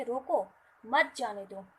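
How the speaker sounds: रोको loud and alone, break, firm loud मत जाने दो; pause after रोको. Shown as a demonstration. Teacher saying the person must be stopped, pause after रोको. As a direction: punch रोको, stop, then मत जाने दो together. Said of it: "कि रोकना है".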